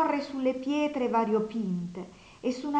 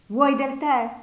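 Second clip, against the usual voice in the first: loud offer; 3 semitones higher, 6 dB louder, slower than usual. A narrator's reading. Nastasia offers tea loudly.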